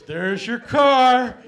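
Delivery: loud, drawn-out grand reveal; booming slow reveal of a wreck; mock grand reveal. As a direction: announce it slowly like a big prize. Reveal of the junk car.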